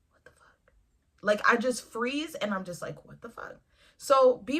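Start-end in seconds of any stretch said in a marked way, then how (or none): 0.1-0.7 breathless whispered disbelief. Retold or imitated. Frozen reaction, minding business. 3.0-3.6 breathless whispered disbelief. Retold or imitated. Frozen, staring reaction.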